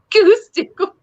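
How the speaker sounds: cracking up mid-word; giggling through the words; laughing. Real, not acted. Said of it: "crack myself up like that".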